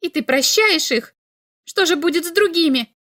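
high, loud outraged questions; angry shout. A narrator's reading. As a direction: demand an answer, furious. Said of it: "крикнула Ульма".

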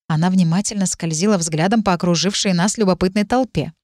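ordinary delivery, nothing marked

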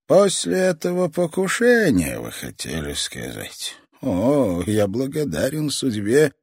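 put-on a menacing old man savouring every word; creepy old man voice. Old toy master speaks.

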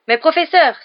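captain begging the professor; a frantic shout; desperate cry. Acted. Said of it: "s'écria-t-il".

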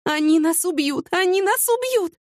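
slow, high cry of terror; panicked. A narrator's reading. Panicked thought during the chase. (в панике, протяжно и высоко)

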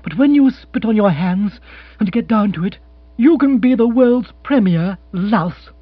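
firm, emphatic insult from an offended woman; cold retort. Acted. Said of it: "she said coldly".

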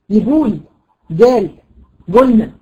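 يقول، جال، قلنا each with g as in "go"; qaf as hard g. Shown as a demonstration. Teacher showing other dialects' habit, qaf as hard g. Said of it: "في بعض البلدان ينطقوها جيم".

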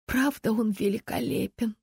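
hushed, admiring exhale; sighed admiration. A narrator's reading. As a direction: breathe it out, smitten. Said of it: "выдохнула Сисси". (восхищённо, на выдохе)

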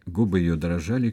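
ordinary delivery, nothing marked

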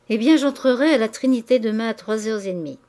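ordinary delivery

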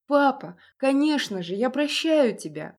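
high, loud overjoyed cry; joyful boy. Acted. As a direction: burst out with happiness. Boy joyfully forgives father.

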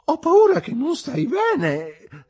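put-on very high, loud, drawn-out drunken wail; loud drunken concern. A narrator's reading. Drunk man worrying about his friend, loud and high. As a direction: blurt it slowly at high volume.

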